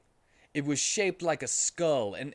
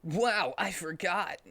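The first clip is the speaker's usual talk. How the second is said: 1 Sonic voice; 7 semitones higher, slower than usual. Acted. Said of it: "said Sonic".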